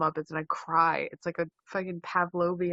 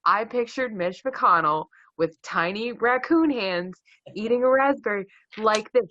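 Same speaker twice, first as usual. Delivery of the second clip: normal talking voice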